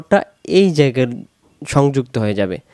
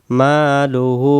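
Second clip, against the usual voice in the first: "মালুহু" very slow with a held final হু; measured recital of "মালুহু", long vowels sustained; elongated vowels; much slower than usual. Shown as a demonstration. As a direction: draw out মা and হু in "মালুহু". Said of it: "হু এক আলিফ".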